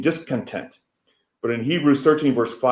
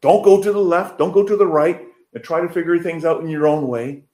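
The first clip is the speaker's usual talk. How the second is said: higher and louder, urgent command; God charging Joshua; 9 semitones higher, 6 dB louder than usual. Acted. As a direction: plead and command at once, pushing hard. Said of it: "God addressing Joshua".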